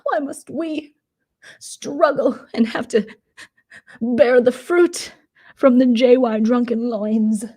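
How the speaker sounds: high, melodramatic whine; mocking Momo. Imitating Momo lamenting to Lumberjack in a high whine. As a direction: wail it like a tragic victim. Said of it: "And Momo's like".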